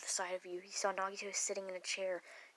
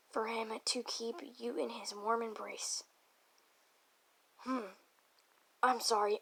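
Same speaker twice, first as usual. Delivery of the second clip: usual speaking voice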